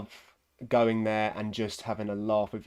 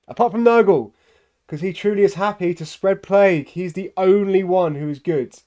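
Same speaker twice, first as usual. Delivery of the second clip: mock praise; 8 semitones higher, 10 dB louder than usual. Ironic praise of plague god.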